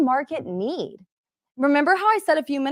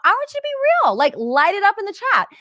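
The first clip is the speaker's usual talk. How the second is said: fired-up plea; 9 semitones higher, 3 dB louder than usual. Real, not acted. Urging audience to open up.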